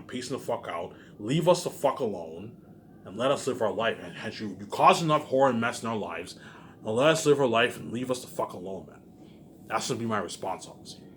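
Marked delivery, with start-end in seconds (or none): none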